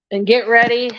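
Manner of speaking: ordinary talk, nothing marked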